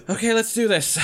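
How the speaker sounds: high-pitched character voice; woken-up fighter. Acted. Game master as Lessa just woken up, high-pitched eager voice. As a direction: put on a high voice, raring to go. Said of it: "She's like".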